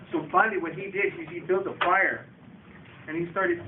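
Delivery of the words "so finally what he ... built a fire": ordinary delivery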